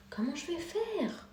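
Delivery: worried stage whisper; désemparé, chuchoté. Acted. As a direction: whisper it as mock helplessness. Joue l'exemple de la plaque oubliée. (chuchotement faussement désemparé)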